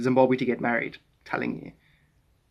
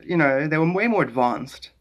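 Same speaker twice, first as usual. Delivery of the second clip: ordinary talk, nothing marked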